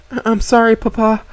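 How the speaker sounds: high, choked-up apology; sorry through tears; tearful apology. A narrator's reading. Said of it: "muffled voice was overcome with emotions".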